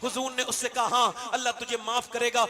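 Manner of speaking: loud fervent sermon appeal; preaching at full force. Acted. Preacher urging young men to repent, loud and fervent. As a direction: call the youth to repent.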